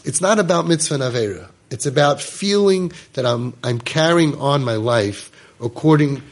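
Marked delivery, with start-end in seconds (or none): none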